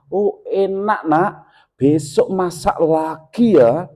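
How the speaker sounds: slow, encouraging praise; gentle praise. Retold or imitated. Father gently praising his daughter's cooking. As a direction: reassure the girl kindly.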